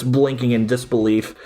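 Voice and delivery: ordinary talk, nothing marked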